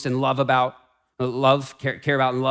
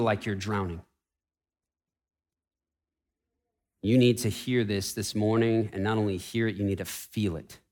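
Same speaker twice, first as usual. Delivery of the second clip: hushed, softer, tender appeal; soft pastoral appeal; 4 semitones lower, 5 dB quieter than usual. Acted. Pastor gently addressing drowning, hurting listeners, soft and tender. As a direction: lean in and speak gently to the hurting.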